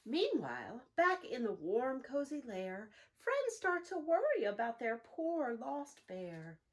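lively, sing-song storybook reading; animated picture-book narration with slow hushed refrains; reading to children. A narrator's reading. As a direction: read it like storytime for little ones. Reads picture book aloud.

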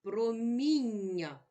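«Проміння» повільно по складах; розтягує «Проміння»; slow reading. Shown as a demonstration. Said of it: "Прочитай слово". (повільне читання по складах)

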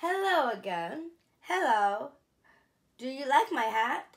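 plain reading voice

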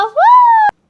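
high, loud joyful whoop; excited whoop. Acted. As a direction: shout with glee as you speed by. Kids whoosh past excitedly. (neşeyle yüksek ve yüksek sesle çığlık)

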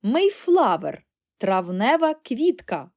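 loud, drawn-out triumphant cry; excited exclamation. A narrator's reading. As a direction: call it out high and loud. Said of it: "вигукнув Чарлі".

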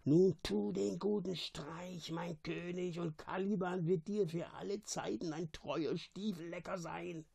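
hushed, high, wheedling creature voice; Caliban hissing. A narrator's reading. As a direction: softly urge your king on in a strained hiss. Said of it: "zischte er".

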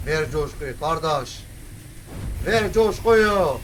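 slow, drawn-out hype cry; rising, slow hype shout; hype call. Real, not acted. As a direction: stretch it out to pump yourself up. Hyping himself up.

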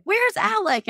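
high sing-song "Where's Alec?"; playful mock wondering. Mother in labour filming friends a video, high playful question. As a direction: pitch it way up, teasing.

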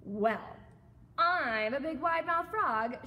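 loud, high frog boast; shouted. A narrator's reading. Frog introduces himself to emu, shouted. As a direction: proudly bellow the introduction. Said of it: "shouted the wide-mouthed frog".

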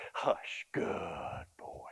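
put-on "Hush" and "Good boy" whispered softly. Shown as a demonstration. Trainer quietly praising the now-silent dog, whispered. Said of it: "how to tell them to be quiet".

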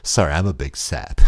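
ordinary talk, nothing marked

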